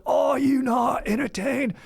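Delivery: high, slow, drawn-out shout; mock gladiator shout. Brother shouting into pinned sibling's ear, slow shout. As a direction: bellow it at the crowd. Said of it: "my brother was like".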